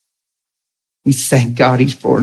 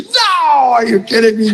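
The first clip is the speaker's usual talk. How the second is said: high laughing mock "No!"; mock shriek; 9 semitones higher than usual. Retelling a woman's panicked reply, high laughing mock no. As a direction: squeal it high like her, laughing.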